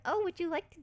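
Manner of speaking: high eager offering voice, lifted way up; high-pitched doting host impression; sweet hosts. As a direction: mimic the sweet, fussing hosts. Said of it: "They're like".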